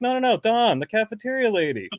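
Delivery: high, hurried correction; imitated insistent correction. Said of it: "They're like".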